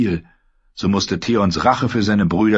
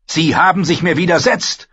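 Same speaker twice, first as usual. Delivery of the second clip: higher, louder defiant shout; shouting in her face; 11 semitones higher, 4 dB louder than usual. Acted. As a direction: yell it right at her. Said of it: "schrie er ihr ins Gesicht".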